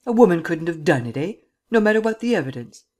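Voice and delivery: a barely contained angry outburst; furious spluttering. A narrator's reading. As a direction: spit the words out in fury. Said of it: "he almost spluttered".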